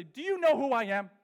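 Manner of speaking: mock outrage at the camp staff, loud and indignant; indignant outburst. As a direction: puff up like a slighted celebrity. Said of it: "wanted to go to the Young Life staff and say".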